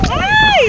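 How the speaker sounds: a sudden high scared yelp; a short real cry of fright; frightened shriek. Real, not acted. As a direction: shriek as the bridge sways in the wind. Crossing windy bridge, frightened.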